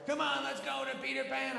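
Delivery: very high child voice, imitated; eager kid. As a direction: pipe up like an impatient kid. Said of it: "my kids are like".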